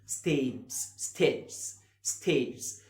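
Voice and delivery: ستيجز with a soft j sound; soft g in stages. Shown as a demonstration. Teacher modeling ستيجز with a soft j for students. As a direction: say ستيجز with the g as a soft j before the final s. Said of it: "بنطقها كده ج".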